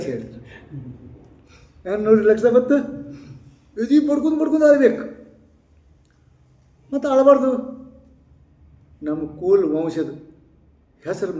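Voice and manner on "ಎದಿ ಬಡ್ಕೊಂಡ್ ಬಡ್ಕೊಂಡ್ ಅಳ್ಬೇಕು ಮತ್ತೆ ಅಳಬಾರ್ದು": mocking high preaching. Preacher mocks the custom.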